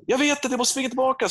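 high, strained yell; acted-out frustrated holler; exasperated shouting back. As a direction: shout back to Dad on the roof. Shouted back to father on roof.

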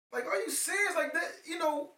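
high, incredulous, half laughing; disbelief. Real, not acted. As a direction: ask it like you can't believe it. Disbelief at actor's husband.

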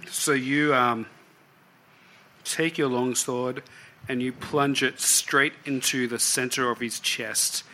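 ordinary talk, nothing marked

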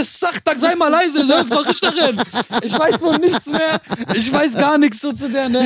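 high, slow whimpering plea; mock whimper. Pleading with the judge in a high slow whimper. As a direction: plead slowly in a high crying voice.

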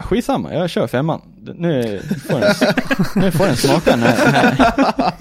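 high, laughing "let's go for it" voice; laughing daredevil. Retold or imitated. To podcast hosts, retelling the reckless gear choice, laughing giddily. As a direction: cackle through it with reckless glee. Reckless decision, retold self-quote.

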